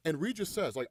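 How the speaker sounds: normal talking voice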